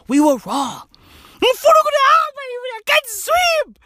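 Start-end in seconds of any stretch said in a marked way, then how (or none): 1.4-3.8 much higher, fast, squealing wail. Retold or imitated. Mocked man wailing he can't swim. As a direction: squeal in terror about swimming. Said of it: "mocked man wailing".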